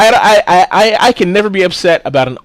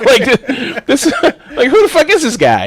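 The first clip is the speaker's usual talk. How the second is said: higher, laughing through the question; cracking up mid-rant; 9 semitones higher, slower than usual. Real, not acted. Laughing while mocking the unknown husband.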